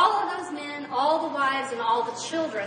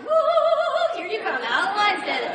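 cheerful hauling call; 7 semitones higher than usual. Retold or imitated. Crowd hauling Daniel up.

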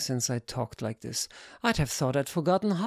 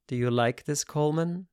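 plain reading voice

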